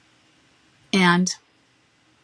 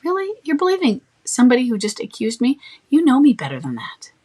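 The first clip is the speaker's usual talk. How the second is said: higher, incredulous Really?, then louder; eye-roll disbelief; 5 semitones higher, 5 dB louder than usual. Shown as a demonstration. Friend asks about his accusation, incredulous eye-roll reply. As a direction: roll your eyes at a friend who believes him. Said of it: "You can eye roll it if you want".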